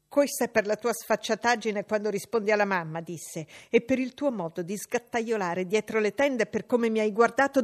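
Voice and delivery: put-on loud, jeering young bully; bullying boy. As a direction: lash out at her like a spoiled brat. John Reed's voice.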